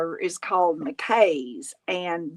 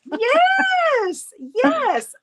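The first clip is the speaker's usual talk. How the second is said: very high, louder, eager yes; excited agreement; 4 dB louder than usual. Real, not acted. Host agrees with friend's video game comparison, excited agreement. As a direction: squeal it in delighted recognition.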